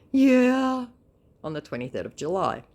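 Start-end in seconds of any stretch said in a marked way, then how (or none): 0.1-0.9 high, loud put-on scammer voice. Scammer replying to her on Instagram, high mock voice. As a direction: ham up the dim scammer.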